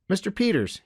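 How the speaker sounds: put-on high boyish voice; young boy trying the polite form; corrected boy restarting. A narrator's reading. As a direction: repeat it as a child would, pitched well up. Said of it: "he began again".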